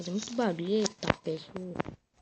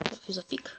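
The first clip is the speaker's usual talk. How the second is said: whispering; 6 dB quieter than usual. Real, not acted. Muttering to himself mid-game.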